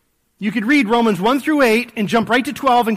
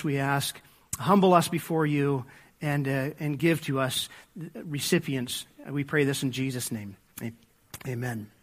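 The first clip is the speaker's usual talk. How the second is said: soft, hushed pastoral prayer fading lower; quiet prayer; 4 semitones lower, 5 dB quieter than usual. Acted. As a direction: pray quietly and humbly toward amen. Pastor prays before the sermon.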